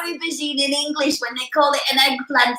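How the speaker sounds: lightly voiced puzzled student; curious learner question. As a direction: play a baffled learner asking why. Mimics learners' typical puzzled question.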